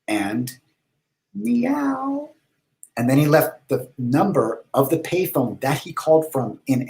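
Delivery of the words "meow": higher, mock cat meow. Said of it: "and he said".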